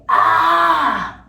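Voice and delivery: loud, high cry of pain; pained scream. Real, not acted. Screams, face hurts.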